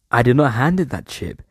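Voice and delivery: ordinary talk, nothing marked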